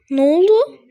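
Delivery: normal talking voice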